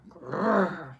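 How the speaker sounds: mock bear growl. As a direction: give a little bear grumble. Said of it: "Old Bear growls".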